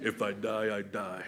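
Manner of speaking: low, soft, gruff action-hero growl; mock Stallone one-liner. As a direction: say it like a tough movie hero about to fight. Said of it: "something Stallone would say".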